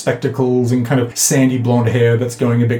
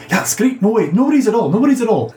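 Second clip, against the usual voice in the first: high-pitched, eager character voice; enthusiastic; 10 semitones higher than usual. Acted. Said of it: "She nods enthusiastically and says".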